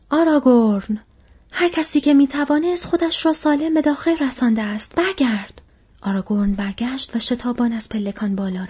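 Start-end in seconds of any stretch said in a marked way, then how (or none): 0.1-5.6 high, loud urgent call across the stairs; shout. A narrator's reading. Legolas calling Aragorn back from the stairs, urgent loud shout. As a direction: yell to him to get back now. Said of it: "فریاد زد".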